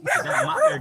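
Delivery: squeaky voice for the dogs; high pet voice. Real, not acted. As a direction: pitch way up, like talking to a puppy. Calling out to the dogs.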